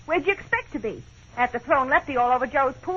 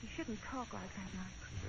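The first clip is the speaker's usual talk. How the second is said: much softer, gentle reproach; soft chiding; 5 semitones lower, 19 dB quieter, slower than usual. Acted. Wife to despairing husband, soft gentle reproach. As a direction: hush him tenderly.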